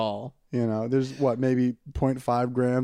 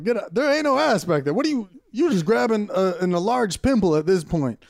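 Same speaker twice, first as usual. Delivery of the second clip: high, mock outraged get-out; mock rebuff; 6 semitones higher, 4 dB louder than usual. To a girl grabbing his ass, mock outraged shout. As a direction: shoo her off in a high put-on voice. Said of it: "and I was like".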